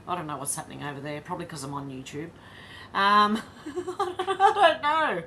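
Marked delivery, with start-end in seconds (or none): 2.9-5.2 laughing it off. Real, not acted. Laughing at own channel troubles.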